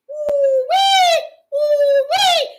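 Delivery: high, loud falling ooh-wee whoop; ooh-wee exclamation. Real, not acted. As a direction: let out a high excited whoop. Preacher's exclamation mid-verse.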